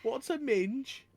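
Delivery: high, loud, drawn-out question; little cousin voice. Pretend. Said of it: "So my little cousin".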